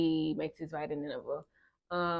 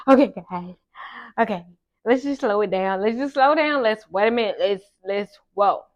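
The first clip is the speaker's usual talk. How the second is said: flustered plea; 2 semitones higher, 7 dB louder than usual. Retold or imitated. Acted plea to God to slow down.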